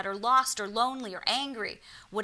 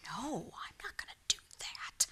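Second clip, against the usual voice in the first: whispered refusal; 2 semitones higher, 9 dB quieter than usual. Retold or imitated. Refusing inwardly to pass a message to a stranger, whispered.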